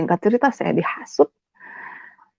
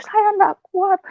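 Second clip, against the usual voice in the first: very high retold wail; high-pitched despairing plea; tearful plea; 11 semitones higher, slower than usual. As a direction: cry out to God, unable to bear it. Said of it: "saya hanya bisa menangis".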